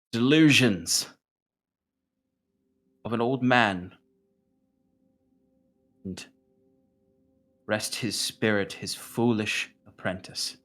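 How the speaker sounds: scoffing. Acted. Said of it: "the Big Boss scoffs".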